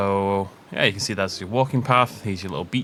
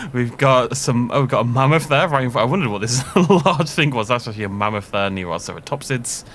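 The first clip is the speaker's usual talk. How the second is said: ordinary talk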